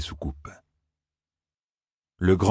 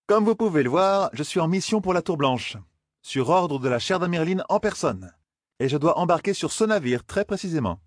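high-pitched, official-sounding insistence; insistent young traveller; 9 semitones higher than usual. A narrator's reading. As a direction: state your mission to the foreman. (jeune voyageur qui insiste)

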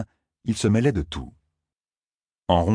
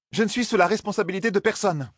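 indignant Zarine; 13 semitones higher than usual. Acted. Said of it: "s'indigna Zarine".

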